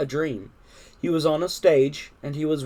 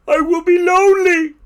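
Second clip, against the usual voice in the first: high, loud wail; shouted plea; 9 dB louder than usual. A narrator's reading. Toad alone in the dark, high shouted lament. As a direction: wail loudly to a departed friend. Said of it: "he shouted".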